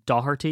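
"Doherty" as DOH-her-tee; correct pronunciation. Shown as a demonstration. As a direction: say "Doherty" with no K sound. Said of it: "it's actually Doherty".

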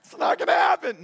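high, loud, fretful bird voice; anxious bird. Pretend. Preacher voicing an anxious bird in a squeaky, loud fret. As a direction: play a frantic little bird. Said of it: "birds aren't walking around anxious going".

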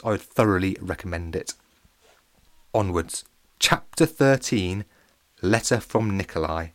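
plain reading voice

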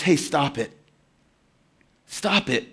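soft, low hush of a rebuke; calm him down, barely above a breath; low, hushed "stop it"; quiet rebuke. Acted. Dying criminal rebukes the other.

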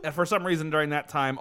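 normal talking voice

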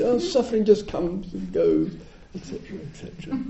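high, airy, trailing off quickly; flippant. Retold or imitated. Teacher mimicking his careless remark, high and airy. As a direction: wave it away like nothing. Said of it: "my flippant, couldn't care less attitude".

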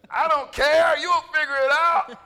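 put-on loud, dismissive kid voice; careless kid. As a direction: brush the parent off loudly. Kid brushing off parent.

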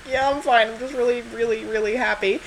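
high, strained happy-crying voice; imitated tearful sobbing. Telling Rachel she's okay at film's end, mock tearful. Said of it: "I was a sobbing wreck".

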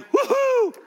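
high, slow drawn-out woohoo; cheer. Shown as a demonstration. Pastor prompting congregation to cheer. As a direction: model the cheer for them. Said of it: "I thought I would get at least".

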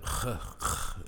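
throaty unvoiced "khh" sound; drawn-out guttural kh, no voice; harsh Arabic kh. Shown as a demonstration. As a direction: demonstrate a harsh back-of-the-throat rasp. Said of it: "they emphasize the".

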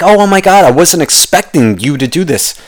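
hammed-up delighted gasp; mock pleasant surprise. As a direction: gush like someone pleasantly shocked. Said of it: "I'll even go positive".

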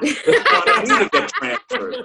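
high, slow laughing through the punchline. Real, not acted. Punchline about the receptionist's stare, slow and high with laughter. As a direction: let the laugh take over, high and drawn out.

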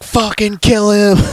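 drawn-out, high-pitched mock crowd yell. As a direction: imitate fans yelling for blood, high and slow. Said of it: "And we're yelling".

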